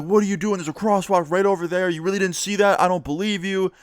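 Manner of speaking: mock the cop's disbelief; imitating a yelling cop. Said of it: "he starts yelling at me again".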